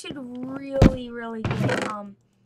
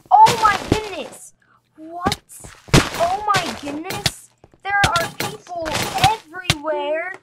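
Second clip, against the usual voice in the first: loud, high alarm; panicked excitement; 5 semitones higher, 10 dB louder than usual. Real, not acted. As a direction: freak out. Chased by many players.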